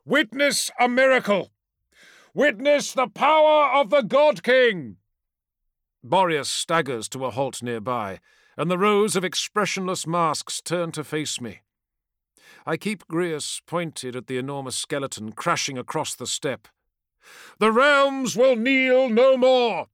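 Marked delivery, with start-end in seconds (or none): 0.0-5.0 loud, high battle proclamation; shouting. Acted. Warrior proclaims a miracle. 17.6-19.9 slow, loud, high battle cry. Acted. Warrior's defiant battle cry.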